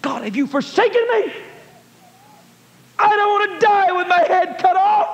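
rising from pleading into a high, loud shout; desperate cry. Retold or imitated. A kidnapped driver crying out to God, high shout.

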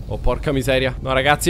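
normal talking voice

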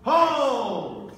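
loud, sharp call to stop; shouted command. Real, not acted. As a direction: bark the halt across the floor. Referee halting fencers.

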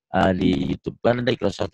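ordinary talk, nothing marked

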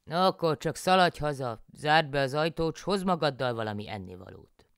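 loud, high farmer giving orders; husband János. A narrator's reading. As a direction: send her off home, bossy. János orders wife home.

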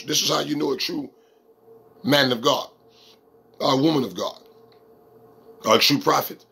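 ordinary talk, nothing marked

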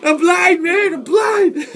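high, loud crying shout; a high, loud panicked cry; screaming blind panic. Acted. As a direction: shriek that you can't see.

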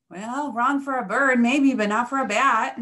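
animated bat putting a youngster right; emphatic rebuttal. A narrator's reading. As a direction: push back loudly, stretching the words. Bat rebuts what Mama Bird said.

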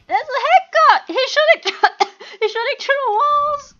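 high, loud outraged accusation, imitated; mock yelling player. Mimicking a player calling out a hacker, mock yelling. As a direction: yell it like an accusing gamer, laughing through it. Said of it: "people yelling".

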